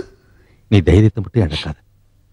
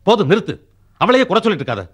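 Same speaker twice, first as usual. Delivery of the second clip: much higher, heated shouting; angry outburst; 13 semitones higher than usual. Acted. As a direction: erupt in frustration at home. Son explodes at mother.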